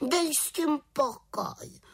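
very high, drawn-out witchy grumble; peevish. A narrator's reading. Little witch fed up with scolding, peevish. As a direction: brush him off crossly, high and slow. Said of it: "vykřikla mrzutě".

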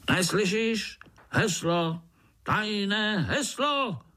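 high, insistent sentry cry; shouted demand. A narrator's reading. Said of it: "křičel ten největší z nich".

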